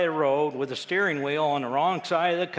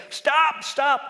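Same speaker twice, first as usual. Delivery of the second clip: loud, much higher cry of "stop"; retold shout; 12 semitones higher, 9 dB louder than usual. Telling Scottish coworkers to stop talking, mock yell. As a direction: throw up a hand and cut them off. Raised hand, baffled by Scots.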